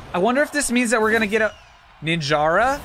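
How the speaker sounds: ordinary talk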